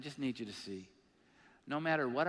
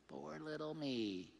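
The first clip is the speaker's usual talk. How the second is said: mock self-pity; 6 dB quieter, slower than usual. Mocking his own self-pity.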